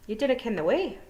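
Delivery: high Scottish Feegle voice. A narrator's reading. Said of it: "said Rob Anybody".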